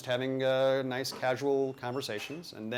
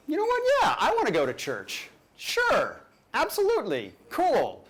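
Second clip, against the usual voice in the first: eager yes; 10 semitones higher than usual. Retold or imitated. Eager quoted agreement.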